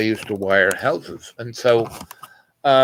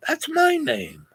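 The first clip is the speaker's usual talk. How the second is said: high, soft voice of the other guy; lightly voiced stranger, high and quiet; surprised stranger; 4 dB quieter, slower than usual.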